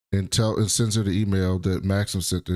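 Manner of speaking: usual speaking voice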